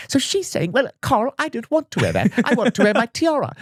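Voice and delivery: very high-pitched pampered princess voice; Princess Donut. Acted. Audiobook princess refusing Carl's request, very high princess voice. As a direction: put on a high, fussy princess character. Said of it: "Princess Donut talks like this".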